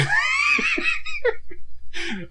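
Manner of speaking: big shrieking laugh. Real, not acted. Laughing at joke.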